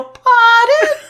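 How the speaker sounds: put-on high, loud, prim "Pardon?" like a well-mannered child; a mock well-mannered Australian child; polite little kid. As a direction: pipe up sweetly and properly like a tiny polite kid. Imagined trained kids.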